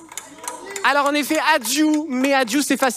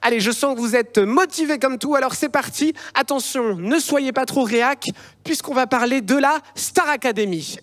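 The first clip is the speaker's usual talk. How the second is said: usual speaking voice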